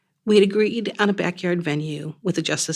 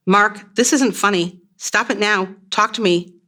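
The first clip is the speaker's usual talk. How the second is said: louder and higher, slow desperate plea; yelling in panic; 5 semitones higher, 5 dB louder than usual. Acted. Wife yelling at her dying fiancé, desperate. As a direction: yell at him to stop. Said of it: "Still yelling at him".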